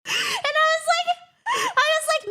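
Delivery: high, breaking through giggles; can barely get it out for laughing; giggly lead-in. Real, not acted. Laughing between quotes.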